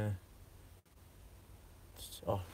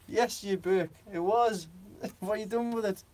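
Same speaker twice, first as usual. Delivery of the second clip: higher, laughing wife's telling-off; wife scolding her husband Jeff, laughing and pitched up; mock scolding wife; 8 semitones higher than usual. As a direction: tease him as the cross wife.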